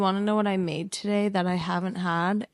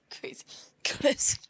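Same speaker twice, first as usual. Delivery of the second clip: whispered crazy. Real, not acted. Friends riffing on "crazy.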